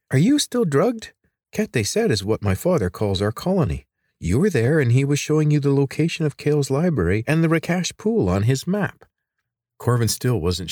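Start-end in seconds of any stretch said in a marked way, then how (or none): 0.1-9.1 put-on girl's voice, higher and exasperated; a teasing, impatient girl; lightly voiced girl, a touch incredulous.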